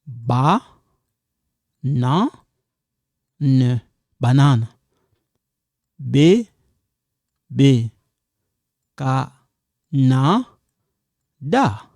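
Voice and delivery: slow syllable by syllable, each one apart; broken into syllables. Shown as a demonstration. Teacher breaking words down for beginners. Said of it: "That's why I break them down".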